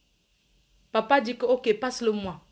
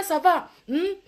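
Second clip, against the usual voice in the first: ordinary talk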